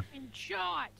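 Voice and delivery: very high, loud squeal of a curse; cursing outburst. Real, not acted. Cursing outburst during the race.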